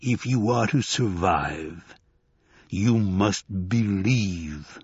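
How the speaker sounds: slow, low creature rumble; rumbling voice. A narrator's reading. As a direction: draw it out like a buffalo god. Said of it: "said the rumbling voice".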